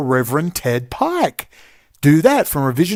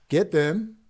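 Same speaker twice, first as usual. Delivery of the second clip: pulled back to a softer voice; quieter; 2 semitones higher, 6 dB quieter than usual. Real, not acted. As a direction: catch yourself and drop the volume. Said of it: "oops, sorry, I'm talking too loud".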